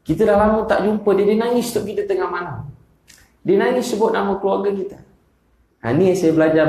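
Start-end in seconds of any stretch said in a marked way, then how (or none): 0.1-5.0 higher, impassioned pitch; stirred, rising plea to the audience; emotional preaching. Real, not acted. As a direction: let your voice lift with feeling. Moved preacher recalls weeping teacher.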